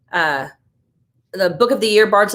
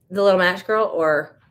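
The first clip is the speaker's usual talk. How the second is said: usual speaking voice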